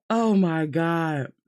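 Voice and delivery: ordinary delivery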